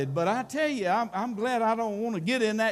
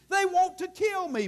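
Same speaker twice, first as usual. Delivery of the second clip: much higher, pressing the repeat; Paul's fear, climbing; 9 semitones higher than usual. Retold or imitated. Preacher repeats Paul's cry as the danger sinks in, higher. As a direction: push the repeat up into alarm.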